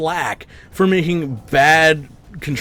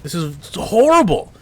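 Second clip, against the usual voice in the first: angry rant; 5 semitones higher than usual. Real, not acted. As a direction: vent furiously at the developers. Ranting at game developer.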